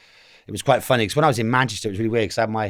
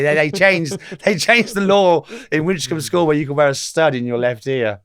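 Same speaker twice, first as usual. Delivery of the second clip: usual speaking voice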